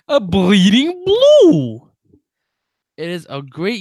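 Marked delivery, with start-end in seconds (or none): 0.1-1.9 big, loud, stretched Southern-style plug; put-on Southern accent. Host plugging a sponsor podcast, hammed-up Southern accent. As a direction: belt it out high and slow in a mock Southern accent. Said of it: "come up with that accent".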